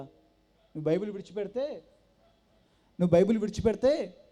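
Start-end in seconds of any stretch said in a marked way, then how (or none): 3.0-4.2 louder, pressing repeat of the condition; loud insistence. Retold or imitated. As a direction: hammer the ultimatum home. Brother-in-law repeats ultimatum louder. (బావ గట్టిగా నొక్కి చెప్పే షరతు)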